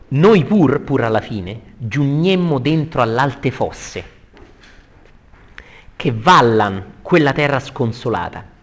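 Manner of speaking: loud, slow, solemn declaiming; verse recitation. Acted. Teacher reading the arrival at the moats, loud slow declamation. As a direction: project each phrase with drawn-out weight. Reads Dante's verse aloud.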